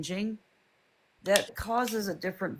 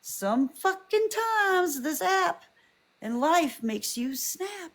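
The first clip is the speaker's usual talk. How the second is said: high, slow, drawn-out exasperation; exasperated emphasis; 10 semitones higher, slower than usual. Real, not acted. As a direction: stretch it out, fed up. Emphatic frustrated rant.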